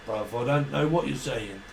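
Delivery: ordinary delivery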